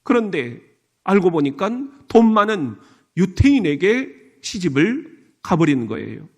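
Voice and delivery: ordinary talk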